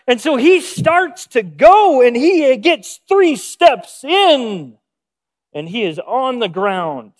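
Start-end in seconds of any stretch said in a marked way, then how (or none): none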